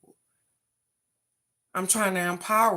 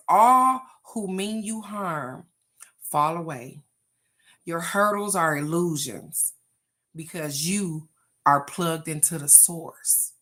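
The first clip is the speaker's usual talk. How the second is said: proclaiming a card; 2 semitones lower, 5 dB louder, slower than usual. Acted. Reading an inspirational card aloud.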